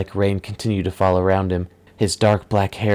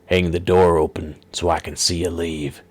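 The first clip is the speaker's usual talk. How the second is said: villain's order; 2 semitones lower than usual. Acted. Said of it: "the man instructed".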